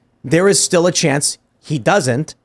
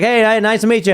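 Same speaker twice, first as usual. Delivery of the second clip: light friendly greeting voice; 7 semitones higher than usual. Retold or imitated. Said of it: "He's like".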